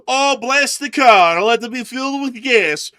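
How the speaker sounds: high, loud mock priest blessing. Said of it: "Russian priest to bless".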